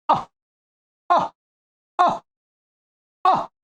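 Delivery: अ ending in a light breathy h echo; अ with a faint trailing h, never a full हा; visarga demo. Shown as a demonstration. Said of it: "अ के पीछे विसर्ग लगाया".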